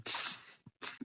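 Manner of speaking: short sibilant snake hisses; voiceless serpent sound; snake hiss imitation. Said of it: "la maleza que hacían".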